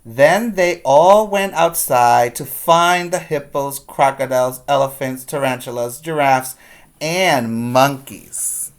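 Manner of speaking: put-on lively storybook reading for kids; read-aloud story voice. A narrator's reading. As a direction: read it like a teacher to the class.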